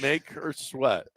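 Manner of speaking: Makersweat stretched out slowly; slow, deliberate Makersweat. Shown as a demonstration. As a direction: draw out each part of Makersweat, taking your time. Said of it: "No, don't slow it down".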